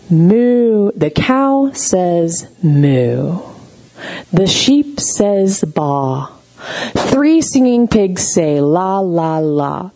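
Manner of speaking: put-on slow, drawn-out toddler story reading; reading a picture book. Shown as a demonstration. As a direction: recite it slowly for a baby. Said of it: "here's how it goes".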